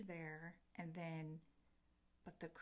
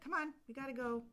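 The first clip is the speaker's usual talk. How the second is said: hurried; 8 semitones higher, 6 dB louder, much faster than usual. Retold or imitated. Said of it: "she's like".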